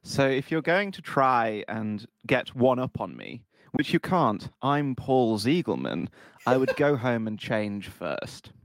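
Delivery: upper-class English snob; posh Queen's English. Acted. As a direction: look down your nose in posh Queen's English. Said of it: "really cliche British... Southern Queen's English".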